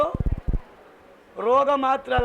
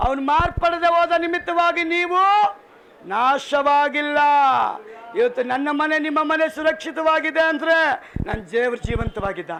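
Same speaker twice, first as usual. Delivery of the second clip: raised, driving sermon delivery; preaching; 4 semitones higher, 3 dB louder than usual. Real, not acted. Preacher assuring congregation God is living, preaching with force. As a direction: build each long phrase from the pulpit.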